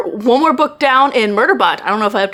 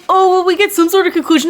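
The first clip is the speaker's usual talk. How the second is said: mocking other readers; 8 semitones higher, 3 dB louder, faster than usual. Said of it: "some people are like".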